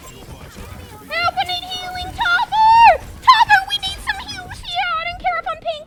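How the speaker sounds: loud high desperate call for healing; frantic shout for heals mid-fight; yelling for heals. Real, not acted. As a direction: scream for your healer. Begging teammate for heals.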